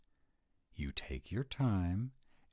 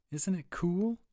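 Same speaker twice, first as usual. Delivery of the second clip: excited; 14 semitones higher, 5 dB louder than usual. Acted. Excited over the piping.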